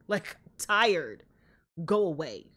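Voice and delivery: normal talking voice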